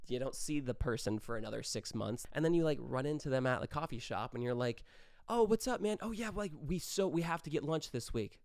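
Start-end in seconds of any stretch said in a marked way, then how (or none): 5.3-8.4 high, bright, overeager greeting that means nothing; fake enthusiasm. Running into an acquaintance at the coffee shop, fake excited. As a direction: gush like you'll never follow through.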